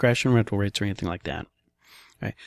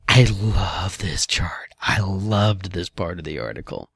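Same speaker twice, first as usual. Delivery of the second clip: slow, lower whisper of delight; 3 semitones lower, slower than usual. Real, not acted. Host whispering his love for the article's chart. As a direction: savor it in a hushed whisper.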